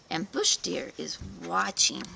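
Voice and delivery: ordinary delivery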